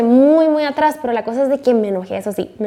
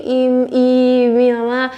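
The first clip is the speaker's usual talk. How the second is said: ordinary talk, nothing marked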